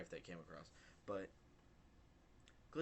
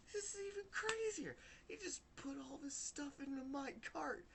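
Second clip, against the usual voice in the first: much higher, strained near-crying disbelief; complaining to the manager, incredulous and high; incredulous squeal. Retold or imitated. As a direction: squeak it out in outrage. Telling manager about absurd prank.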